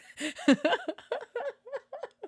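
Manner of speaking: loud, high burst of laughter; helpless laughter. Real, not acted. Speaker laughing at an accidental throat squeak, high and helpless. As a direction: crack up at yourself.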